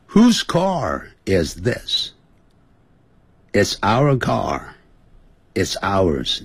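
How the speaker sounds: 带读示范. Shown as a demonstration. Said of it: "从头到尾再跟着来念一下".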